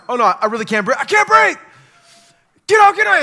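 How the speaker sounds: starts worried, builds to a high loud frantic yell; rising panic. Retold or imitated. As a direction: let the fear climb until you're screaming. Realizing he really can't breathe.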